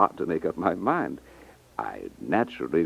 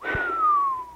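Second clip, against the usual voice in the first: admiring sigh. Acted. Customer first sees the ruby.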